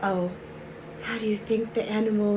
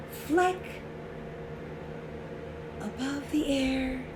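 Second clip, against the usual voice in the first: soft, tentative storytelling build-up; hushed suspense; 3 semitones higher, 7 dB quieter than usual. A narrator's reading. Warning children something's gonna happen, hushed suspense. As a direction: keep it hushed as the pepper floats. Suspense before the bear's sneeze.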